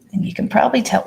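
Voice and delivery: ordinary delivery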